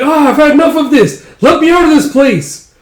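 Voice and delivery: very high, loud cry; acted frantic escape cry; panicked yell. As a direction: shriek to get out, in a panic. Onlooker panics and flees.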